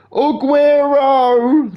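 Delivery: high, drawn-out commentator cry; imitating an over-excited goal call; mock commentator shout. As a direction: stretch the name out in a loud, high mock yell. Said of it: "everyone keeps fucking shouting".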